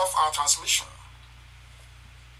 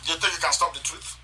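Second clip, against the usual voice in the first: ordinary delivery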